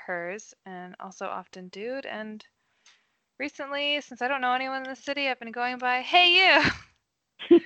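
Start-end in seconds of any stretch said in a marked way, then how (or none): 6.0-7.6 loud high hail to a stranger; mock call out. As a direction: shout it to get a passerby's attention. Calling out to strangers in city.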